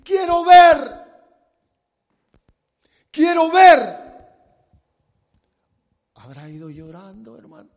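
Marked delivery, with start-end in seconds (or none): 0.0-4.0 slow, loud, high shouted cry; desperate shout. Retold or imitated. Blind man shouting past the crowd to Jesus, loud desperate cry. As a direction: yell over the crowd to be heard. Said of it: "iba gritando".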